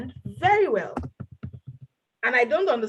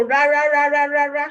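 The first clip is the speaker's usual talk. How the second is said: "ra, ra, ra" chained loud on one raised tone; emphatic repetition; 4 semitones higher, 6 dB louder than usual. Shown as a demonstration. Teacher showing how Yoruba repeats syllables, loud emphatic repetition. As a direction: hammer out ra, ra, ra at a level high pitch. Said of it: "to make you emphasize on the word".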